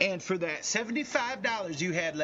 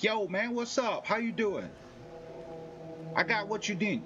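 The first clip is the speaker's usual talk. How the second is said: higher, swaggering street hustler offer; gang member; 6 semitones higher than usual. Retold or imitated. Gang member approaching a stranger at night, higher street swagger. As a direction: lean in like a dealer sizing you up. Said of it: "gang members like".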